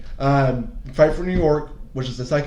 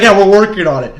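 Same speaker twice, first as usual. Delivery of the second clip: loud mocking corporate; 6 semitones higher, 11 dB louder than usual. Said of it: "They're like".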